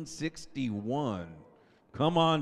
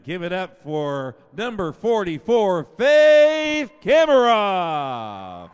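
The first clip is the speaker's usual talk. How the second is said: drawn-out ringing name call, much louder and higher; announcer call; 4 semitones higher, 14 dB louder, slower than usual. Real, not acted. Announcer calls the next senior to the crowd, long ringing shout. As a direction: stretch the name out for the gym.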